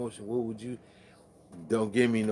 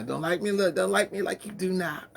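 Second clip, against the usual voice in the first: mock plea; 9 semitones higher, 4 dB louder than usual. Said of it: "We done threw some draws up on stage".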